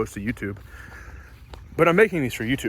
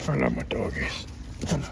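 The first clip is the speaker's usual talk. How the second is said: soft breathy whisper to puppies; whispered dog praise; 10 semitones lower, 9 dB quieter than usual. Real, not acted. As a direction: whisper lovingly to the dogs. Whispering love to dogs.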